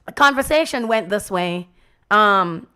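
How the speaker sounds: normal talking voice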